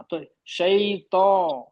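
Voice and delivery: tho-e said very slowly with the mouth open and lips unrounded; slow teacher demo of the sound; drawn-out demo. Shown as a demonstration. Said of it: "منہ کھولیے بس، ہونٹوں کو بالکل نہیں".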